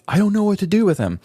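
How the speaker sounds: high, exasperated cry of helplessness; exasperated quote. Retold or imitated. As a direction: throw your hands up, pitched high. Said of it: "And I'm like".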